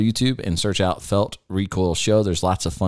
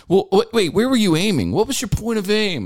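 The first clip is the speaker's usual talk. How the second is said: puzzled RO; 13 semitones higher than usual. Retold or imitated. Range officer asking the shooter where he aimed, puzzled. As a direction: ask like a baffled range officer. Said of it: "the guy's like".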